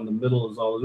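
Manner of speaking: ordinary delivery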